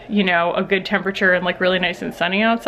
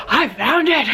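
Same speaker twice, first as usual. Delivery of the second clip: triumphant; 6 semitones higher, slower than usual. Retold or imitated. Retelling her triumph finding bedding.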